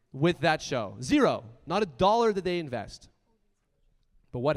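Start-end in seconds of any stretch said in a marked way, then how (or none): 1.0-1.4 zero punched loud on its own, falling; stress on zero. Real, not acted. Speaker repeating it to the crowd, loud zero. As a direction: hammer the single word zero.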